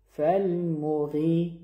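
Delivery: فالموغی with a short mim, lips rounded but not stretched; فالموغی without lengthening the vowel. Shown as a demonstration. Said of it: "به این شکل".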